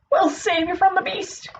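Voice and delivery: high-pitched, restrained storybook yell; light yell. A narrator's reading. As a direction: call out high to the rescue. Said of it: "yelled Mister Cat".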